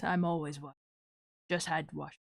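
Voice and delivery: low, soft menacing growl attempt. Shown as a demonstration. Streamer trying out a menacing villain voice for chat, menacing. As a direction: try a low, quiet villain voice. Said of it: "I'll try and do a menacing voice".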